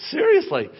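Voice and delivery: high, laughing mock astonishment; amazed parent. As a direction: play a parent stunned by baby's first word. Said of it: "First time your baby says hello".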